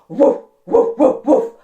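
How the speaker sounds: loud, short woofs; imitated dog bark. Storyteller imitates the noise Bear heard, loud dog barking. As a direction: bark like a dog in quick bursts. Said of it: "it sounded just like this".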